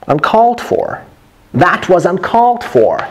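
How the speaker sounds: "uncalled for" pitched high; lively, high model of "That was uncalled for"; natural, expressive delivery. Shown as a demonstration. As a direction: punch "uncalled" high in one connected phrase. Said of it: "say it naturally".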